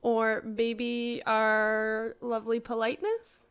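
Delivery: drawn out slowly, with a rise on "politeness"; slow playful mock pride; slow teasing aside. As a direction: stretch it slowly like a playful boast. Topping the accent joke.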